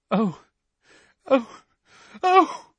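breaking into tears; gulping sobs. A narrator's reading. Molly gulping after seeing the dead bodies, rising sobs. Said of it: "gulped Missus Weasley".

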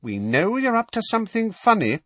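very high, suspicious aunt voice; high-pitched scolding; accusing aunt. A narrator's reading. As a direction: accuse him in a high, piercing voice.

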